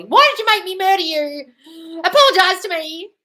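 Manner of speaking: high, loud, slow hammed-up yell; mock yelling. A killer yelling at the dead body, hammed-up outrage. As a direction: rant absurdly at a corpse you made. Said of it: "then you start yelling at the dead body".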